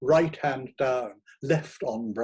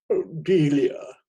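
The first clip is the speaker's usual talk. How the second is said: slow, louder hammed-up lament; pathetic plea; 4 semitones higher, 8 dB louder, slower than usual. A narrator's reading. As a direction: moan her name theatrically. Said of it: "lapsing into the pathetic".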